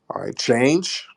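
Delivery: normal talking voice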